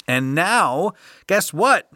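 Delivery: excited teaser. Acted. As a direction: hype up the surprise for the listeners. Teasing build-up to the mail segment.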